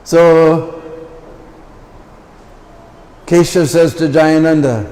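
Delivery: ordinary delivery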